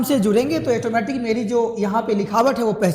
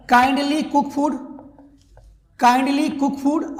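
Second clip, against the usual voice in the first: kindly cook food said very slowly, word by word; slow dictation; much slower than usual. Shown as a demonstration. Teacher dictating slowly to students. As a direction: spell it out slowly for the class. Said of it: "तो या तो बोलिए kindly".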